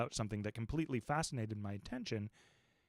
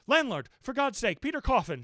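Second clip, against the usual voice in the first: high, loud panicked cry for the landlord; shouting for help; 11 semitones higher, 9 dB louder than usual. A narrator's reading. Narrator yells to the landlord for help, panicked. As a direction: yell for rescue in terror. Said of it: "shouted I".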